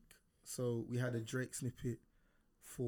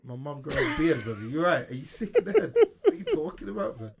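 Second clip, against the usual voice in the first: indignant outburst; 3 semitones higher, 9 dB louder than usual. Real, not acted. Mock outrage at beard question.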